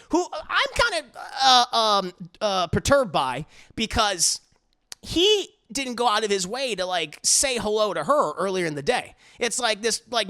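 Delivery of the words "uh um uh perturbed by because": normal talking voice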